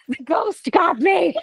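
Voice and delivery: giggling through the joke; laughing. Real, not acted. Host back on the call, laughing about the ghost.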